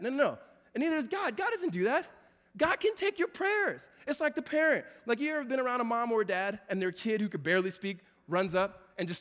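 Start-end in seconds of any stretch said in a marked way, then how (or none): none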